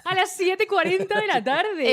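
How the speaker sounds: slow, loud, high promo announcement; announcer voice. Acted. Announcing the show's airtime.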